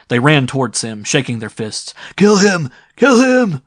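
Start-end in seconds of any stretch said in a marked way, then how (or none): 2.2-3.6 very high, loud raging yell; raving. A narrator's reading. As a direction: rave for his death. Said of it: "raved the old man".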